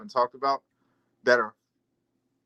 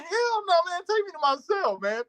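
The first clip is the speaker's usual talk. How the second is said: high excited refusal; 13 semitones higher than usual. Retold or imitated.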